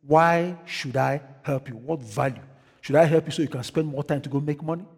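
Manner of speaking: quiet, low, measured divine rebuke; voice from above. Retold or imitated. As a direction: imitate a voice from above, questioning softly. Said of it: "heard almost like audience from his side".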